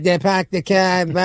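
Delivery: lightly put-on Boston accent, slowed; mock Boston accent. Retelling the bathroom guys, light Boston accent. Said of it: "making fun of, like, the, the Boston accent".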